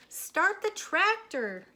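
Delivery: high, loud, slow yell. A narrator's reading. Minnie yelling at Moo to start the tractor. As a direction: bellow the order. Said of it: "yelled Minnie".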